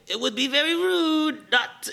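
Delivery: a playful French chef scolding; a light French accent on a polite warning; mock French chef. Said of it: "this is a French chef".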